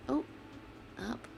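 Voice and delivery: ordinary delivery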